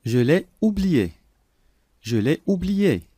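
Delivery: ordinary talk, nothing marked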